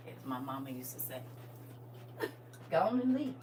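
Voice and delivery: ordinary delivery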